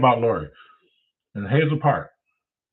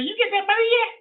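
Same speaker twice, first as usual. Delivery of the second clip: high squeaky voice for the caller; Leonard's voice. Retold or imitated. Retelling the strange caller, high mimicking voice. Caller asks about the money.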